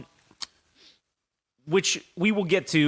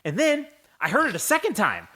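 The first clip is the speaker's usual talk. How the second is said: ordinary talk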